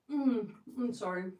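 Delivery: talking through a mouthful; mouth full. Real, not acted. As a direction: apologize while chewing. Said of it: "I'm talking with my mouth full".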